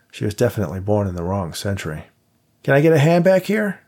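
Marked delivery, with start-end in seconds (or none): 2.6-3.8 loud, high call for help across the rain; shouted call. Acted. Dave shouting to Jennifer through the rain for a hand, loud. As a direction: shout it from the back of the van. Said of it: "Dave shouted".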